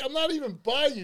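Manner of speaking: loud, high-pitched retold protest; indignant retort. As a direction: throw it back at the complainer, exasperated. Retold beach argument with a stranger.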